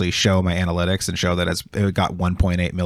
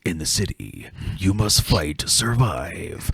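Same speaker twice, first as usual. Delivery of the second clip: movie trailer voice; 2 semitones lower, 6 dB quieter, slower than usual. Retold or imitated. Retelling a comedian's trailer bit, slow dramatic narrator. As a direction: draw out each phrase like an epic trailer. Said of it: "this one whole trailer he does".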